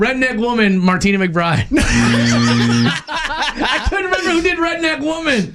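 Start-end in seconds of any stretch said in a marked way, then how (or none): 1.7-5.5 laughing. Real, not acted. Laughing at own mistake.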